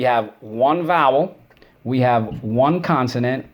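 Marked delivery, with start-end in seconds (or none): none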